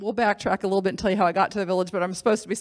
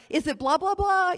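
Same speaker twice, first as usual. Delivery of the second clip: high questioning; 10 semitones higher than usual. Retold or imitated. Kelly asking over music.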